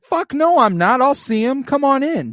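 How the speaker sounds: high, loud hammed-up outburst; loud, high, comic shout of a sick king barging in; mock yell. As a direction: bark it out like an angry old king. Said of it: "And he's like".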